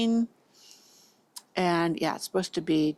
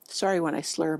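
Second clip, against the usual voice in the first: usual speaking voice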